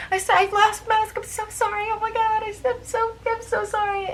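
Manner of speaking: high, flustered mock-panicked apologizing; panicked apology. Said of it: "and I'm like".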